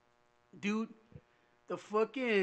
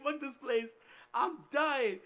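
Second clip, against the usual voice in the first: high, loud mock-tearful complaint; hammed-up kid begging to be rescued; retold desperation; 7 semitones higher, 4 dB louder, slower than usual. Son complaining to mom from boot camp, mock desperation.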